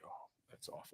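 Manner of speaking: barely audible retold reaction; hushed aside. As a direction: say it under your breath, almost voiceless. Retold private reaction to rival result.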